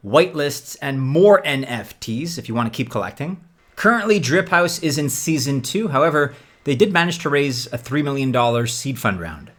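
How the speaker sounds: ordinary talk, nothing marked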